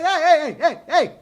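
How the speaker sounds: loud, high shouting at the clerk; hammed-up outburst he never really made; pretend yell. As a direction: yell at him to stop typing. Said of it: "No, I didn't talk like this".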